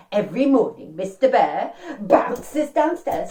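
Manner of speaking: ordinary delivery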